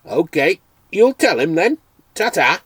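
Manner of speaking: a cheerful Dales farmer calling at the door; Yorkshire farmer. Acted. Farmer leaves.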